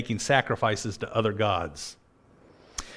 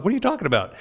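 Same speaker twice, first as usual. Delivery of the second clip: high, baffled question; a young man caught off guard; pitched way up in disbelief; startled; 9 semitones higher, much faster than usual. Retold or imitated. Young prophet's startled reply.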